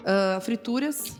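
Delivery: ordinary talk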